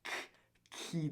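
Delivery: ordinary talk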